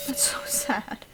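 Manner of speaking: slow breathy whisper of "so sad"; whispered mock pity. Real, not acted. Player repeating the joke under the breath, whispering. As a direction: hush it like a secret.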